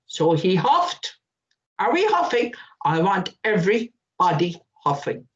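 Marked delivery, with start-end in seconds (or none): none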